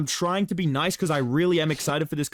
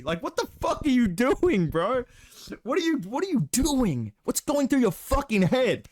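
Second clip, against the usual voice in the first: higher, incredulous what-are-you-doing; exasperated rant; 7 semitones higher than usual. Real, not acted. Ranting at the UFC matchmakers, exasperated and incredulous. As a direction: throw the question at them in disbelief.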